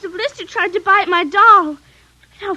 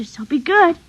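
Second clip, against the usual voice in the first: ordinary delivery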